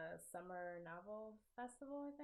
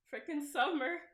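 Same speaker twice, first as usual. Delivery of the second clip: louder and higher, fed up with herself; exasperated at wasting time; exasperated outburst; 7 semitones higher, 9 dB louder than usual. Real, not acted. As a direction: blurt it out in self-annoyed disbelief. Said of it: "I'm so disappointed in myself".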